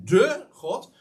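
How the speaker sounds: "de" pushed out high and full before God; stress on "de". Shown as a demonstration. Preacher insisting on the one God, stress on "de". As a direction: hit the article "de" to set Him apart. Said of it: "Ik hou ervan om dat zo even te benadrukken".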